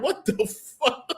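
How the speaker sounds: high, stunned outburst; shocked. Retold or imitated. Reacting to Joe's meltdown, high disbelieving cry. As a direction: squeal it out in disbelief. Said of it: "I'm like".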